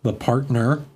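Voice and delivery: ordinary talk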